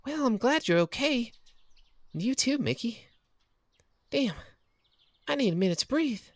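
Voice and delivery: best friend venting her panic after racing over; Kathy relieved and shaken. Acted. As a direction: vent the fright, then settle.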